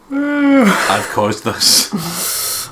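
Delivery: high, loud, very slow lament; hammed-up guilt. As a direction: wail it like a melodrama. Dramatic mock guilt.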